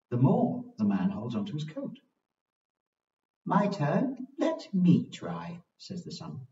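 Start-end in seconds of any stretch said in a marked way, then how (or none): 3.4-5.6 bright, high, slow sun voice; warm beaming sun voice. Acted. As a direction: beam it out like the sun. The sun takes its turn.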